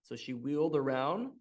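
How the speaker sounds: usual speaking voice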